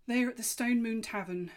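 a fawn brightly declaring the place; fawn's announcement. A narrator's reading. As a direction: proudly call out the answer.

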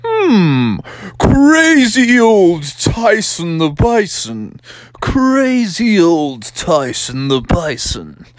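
slow, drawn-out fond musing; musing storybook character. Acted. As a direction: stretch each word, starting high and sliding down. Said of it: "and Froggy's just like".

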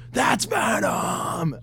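high, slow, drawn-out excited yell; bad Keanu Reeves shout. Fan repeating his theater outburst as a slow shout. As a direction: stretch it like a dazed action star. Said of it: "a bad Keanu Reeves".

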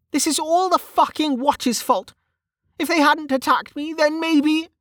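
high-pitched frustrated character voice; Velika, frustrated. Acted.

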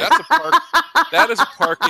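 bursts out high and loud, laughing; excited laughing announcement. Real, not acted. Laughing intro of the park.

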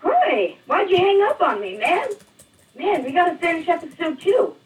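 high, loud, chirpy greeting; loud, high, over-eager complaint; suddenly upbeat. Acted. Caller back, suddenly acting casual.

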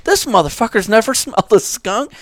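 loud, high-pitched disbelief; acted-out astonished realisation; retold amazement. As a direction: exclaim it, amused, with a laugh breaking in.

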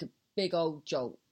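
ordinary talk, nothing marked

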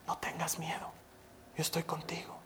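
very quiet, breath-only reassurance; soft into the ear. Retold or imitated. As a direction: whisper it to a frightened baby. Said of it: "empecé a hablarle suavito al oído".

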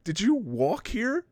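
high, drawn-out disbelieving scold; high-pitched mock outrage; incredulous scolding. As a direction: scold a fool in exaggerated disbelief. Helen scolding in retold scene.

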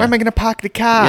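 high, loud Boston impression; mock Boston accent. Quoting the Super Bowl car commercial to friends, hammy Boston accent. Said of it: "Your accent is the most annoying".

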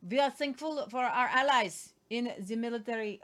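mock Russian accent with rolling v sounds; Ukrainian Russian accent. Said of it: "where's my Russian? Where's my Ukrainian Russian?".

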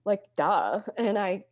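usual speaking voice

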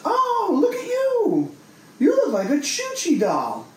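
put-on high, sing-song coo at a child; creepy cooing woman. Creepy woman cooing at a four-year-old on the subway. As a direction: coo over the little girl like a creepy stranger.

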